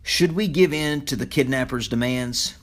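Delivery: ordinary delivery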